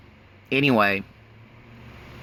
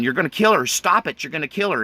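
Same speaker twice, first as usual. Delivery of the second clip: retold desperate yell; 6 semitones higher than usual. Teen son yelling at stepfather choking his mom, desperate shout. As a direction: yell at him to stop, half re-lived. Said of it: "And so I'm yelling".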